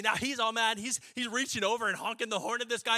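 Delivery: normal talking voice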